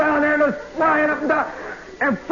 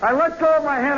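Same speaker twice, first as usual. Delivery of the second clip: ordinary delivery, nothing marked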